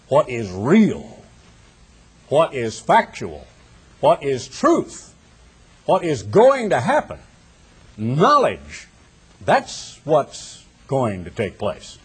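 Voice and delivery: each phrase slow and weighty, set apart by pauses; slow emphatic preaching. Real, not acted. Preacher to congregation on truth over belief, slow and emphatic. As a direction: lay down every phrase like a verdict.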